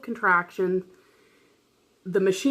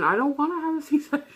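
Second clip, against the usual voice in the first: high-pitched wail without real volume; mock crying scream; 8 semitones higher, faster than usual. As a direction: imitate yourself wailing in protest, voice pitched high. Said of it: "I'm crying, screaming".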